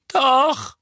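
put-on loud, high-pitched interjection from a dazed boy; high voice of Dudley piping up; mumbling boy. A narrator's reading. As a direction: blurt it in a high, muffled boy's voice. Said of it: "murmelte Dudley unerwartet".

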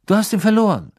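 high, loud disbelieving echo; doubtful. A narrator's reading. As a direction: repeat it as if you don't believe a word. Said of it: "wiederholte Mister Diggory zweifelnd".

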